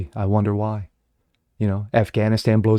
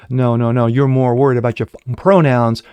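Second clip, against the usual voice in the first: normal talking voice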